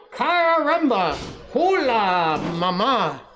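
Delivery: high, loud cry with a Spanish flavour; excited Spanish greeting from a baby chick; Spanish exclamation. A narrator's reading. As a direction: greet mama with Spanish gusto. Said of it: "That's my Spanish".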